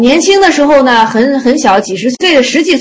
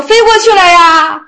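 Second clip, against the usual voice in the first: high, lifted reply with a louder end; innocent answer; 7 semitones higher, 4 dB louder than usual. Retold or imitated. Disciple answers master about the wild ducks, innocent high reply.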